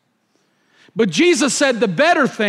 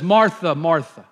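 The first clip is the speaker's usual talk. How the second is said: gentle compassion; 7 semitones lower than usual. Retold or imitated. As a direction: call her name gently, full of compassion. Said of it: "in his gentle, loving, compassionate voice says".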